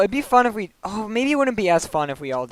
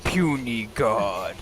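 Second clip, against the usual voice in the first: Hulk voice; 8 semitones lower, 6 dB quieter, much slower than usual. Retold or imitated. Echoing the Hulk line.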